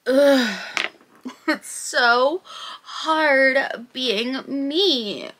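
mock self-pity. Self-pitying mock complaint.

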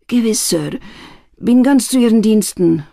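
put-on high, panting old man; breathless old speaker. A narrator's reading. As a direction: gasp it out like a frightened old man. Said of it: "keuchte der alte Mann".

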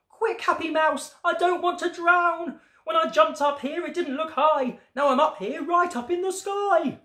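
high-pitched panicky frog; stuck frog, urgent and high; shouted plea. A narrator's reading. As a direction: call down for help in a high storybook voice. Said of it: "the frog shouted down".

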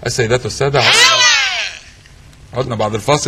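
low formal broadcast voice; announcer voice. Acted. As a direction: announce it like a TV host. Host announcer read.

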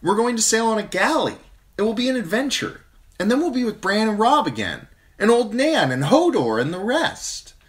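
high, loud, bright-eyed excitement; eager girl. A narrator's reading. Younger sister cheering up Sansa, high and eager. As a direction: sell the voyage as an adventure.

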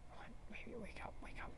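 hushed, whispered pleading; urgent whisper. Acted. Pleading with baby to wake up, whispered. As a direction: whisper it to someone unconscious. Urging someone unconscious to wake.

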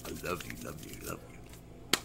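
murmured love you, dying to a whisper; fading to a whisper. Real, not acted. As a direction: let each love you fade away until it's breathed. Repeated loving sign-off, fading.

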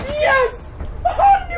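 high-pitched victory scream; ecstatic cheer. Real, not acted. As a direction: squeal as the round is won. Winning the round.

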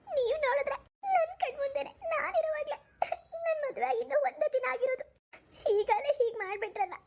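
wounded, high-pitched weeping complaint; tearful. Acted. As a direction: cry through the words, drawing them out. New wife weeping at apparent betrayal.

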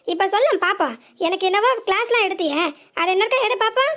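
loud, jeering telling-off; stern elder sister taunting; scolding taunt. Acted. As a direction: rub it in, loud and mocking. Elder woman scolding the cowed girl.

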